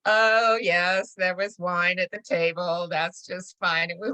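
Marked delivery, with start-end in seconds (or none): none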